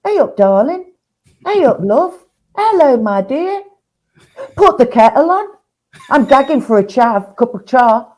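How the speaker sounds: high sing-song Nottingham accent; Nottingham greeting. Shown as a demonstration. Showing co-host how Nottingham folk greet, high Nottingham lilt. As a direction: chirp it up high like a Nottingham local. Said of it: "sweetheart type of thing".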